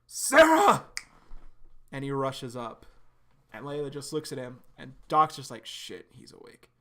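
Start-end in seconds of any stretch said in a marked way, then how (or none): 0.0-0.8 screamed high and loud; anguished scream. Acted. Flashback, Sarah stabbed. 5.7-6.7 hushed whisper under the breath; whispered aside. Acted. Doc caught off guard, aside.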